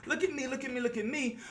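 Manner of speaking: mock someone begging for attention; mocking show-off. A hint of mocking someone showing off, lightly voiced. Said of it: "Oh, look".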